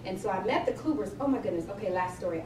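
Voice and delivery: usual speaking voice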